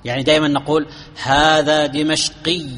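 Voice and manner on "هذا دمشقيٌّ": drawn-out دمشقي ending in a clear -iyyun; case ending sounded. Shown as a demonstration. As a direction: stretch هذا دمشقي slowly, sounding the final -un. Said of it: "ظهرت الحركة". (نطق دمشقي ببطء مع إظهار التنوين «دمشقيٌّ»)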